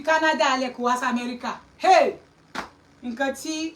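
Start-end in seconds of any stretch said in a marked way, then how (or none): 1.8-2.2 sharp loud "Hey!" of disbelief; outraged exclamation. Real, not acted. To the live audience, a loud shocked hey. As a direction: bark it out in shock.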